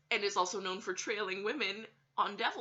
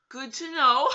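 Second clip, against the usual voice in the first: loud, high laughing good to know; laughing sarcasm; 6 semitones higher, 11 dB louder than usual. Real, not acted. Laughing sarcasm on hearing the man trailed girls. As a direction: toss it off with a nervous laugh. Reacting to creepy news.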